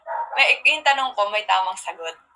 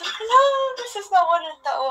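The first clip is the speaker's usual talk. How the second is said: much higher teary wail breaking into a sob-laugh; retold sob, pitched far higher with a real crack; crying while laughing; 10 semitones higher than usual. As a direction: let the crying and laughing tangle together. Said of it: "habang naiyak ako, tumatawa ako".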